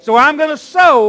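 loud, rallying call to the congregation; fervent church shout; preacher's declaration. Real, not acted. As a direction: call it out for the crowd to repeat.

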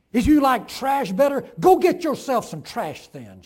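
loud bitter taunt; railing. A narrator's reading. The farm wife rails at God, loud and bitter. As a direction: throw it at God in disgust. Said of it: "she railed".

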